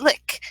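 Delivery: "lick" ending in a hard k; pronunciation lick. Shown as a demonstration. Reader offering another pronunciation of lich, hard k. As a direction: say "lick" with short i and k. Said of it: "what is the proper pronunciation".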